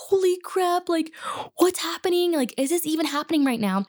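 high, breathy half-whisper of shock; stunned disbelief. Retold or imitated. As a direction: gasp it out, eyes wide. Stunned reaction to student screaming.

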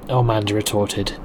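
usual speaking voice

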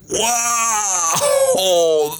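sharp high yelp of alarm; startled whoa. Retold or imitated. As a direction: cry out as the deal collapses. Reacting to buyers backing out.

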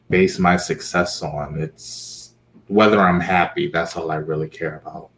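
usual speaking voice

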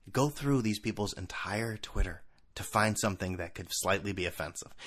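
usual speaking voice

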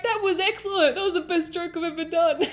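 high, excited praise ending in a laugh; performer breaking character. Retold or imitated. Performer praises visitor's comeback, high excited break from character. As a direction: imitate a delighted performer dropping the act. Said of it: "he broke character for a second".

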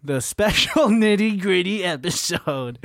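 drawn-out mock announcement; teasing imitation. Co-host mocking the nitty-gritty delivery, teasing imitation. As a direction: stretch it out, laughing a little.

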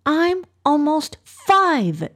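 put-on high, slow indignant child; angry. A narrator's reading. Noor correcting Ibrahim about her age, angry. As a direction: protest in a high little-girl pout, stretching the words. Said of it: "stomped her foot in anger".

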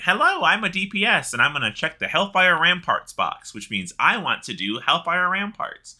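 high-pitched, lightly hammed-up self-introduction; mock eager applicant. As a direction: announce yourself hopefully, in mock earnest. Acts out listing himself for group.

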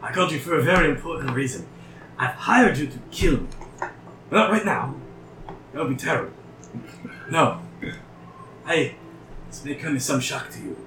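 a lightly voiced mad scientist; eccentric doctor voice. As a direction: lightly play the eager inventor. GM voices the Mind Flayer doctor.

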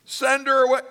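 high-pitched mimicked dismissal; hammed-up disciple voice; mock begging. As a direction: echo the plea high and incredulous. Mimics disciples, then scolds them.

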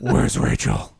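low rasping Batman growl. Pretend. Deep Batman voice joke.

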